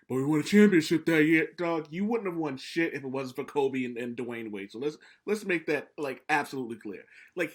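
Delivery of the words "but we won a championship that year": louder, a light Shaq brag; mock Shaq boast. Host mimicking Shaq's usual comeback, louder mock brag. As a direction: declare it like the final word. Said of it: "the same thing with him".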